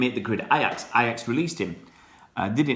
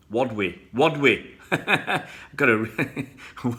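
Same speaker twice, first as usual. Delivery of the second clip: laughing; 3 semitones higher, slower than usual. Real, not acted. Laughing at misreading a name.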